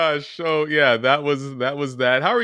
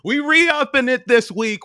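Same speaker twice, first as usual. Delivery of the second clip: excited hype; 11 semitones higher, 3 dB louder than usual. Real, not acted. Hype announcement.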